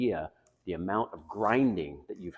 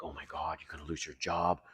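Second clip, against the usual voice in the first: low, soft mock nagging trailing into mumbled da da; lightly voiced nagging ex-wife fading to nothing; 3 semitones lower, 6 dB quieter, faster than usual. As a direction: brush off her nagging as blah blah. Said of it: "my then ex-wife was all over me, like".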